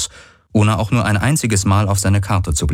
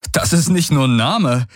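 amused excitement; 7 semitones higher than usual. Acted. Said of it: "er lachte".

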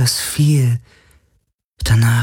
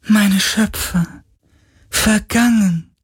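high, slow ghostly lament; tormented soul; slower than usual. Acted. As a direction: wail it like a lost spirit. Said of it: "klagte der Geist".

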